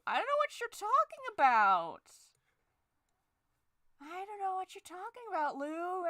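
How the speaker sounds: mock innocent denial. Denying anger in high voice.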